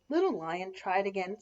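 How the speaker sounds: ordinary delivery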